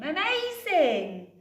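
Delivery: high, slow, drawn-out amazing; amazed. Acted. Little witch amazed at her working spell, slow high wonder. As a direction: marvel at the magic. Betty sees pot simmer.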